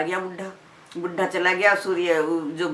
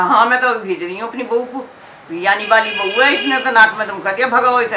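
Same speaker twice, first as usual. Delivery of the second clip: irritated outburst, louder and higher, quick at the end; angry mother-in-law; 4 semitones higher, 5 dB louder than usual. Acted. Mother-in-law sends her daughter-in-law off, annoyed and loud. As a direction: snap it out, fed up with the guest. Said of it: "सास को तो बहुत गुस्सा आ रहा था".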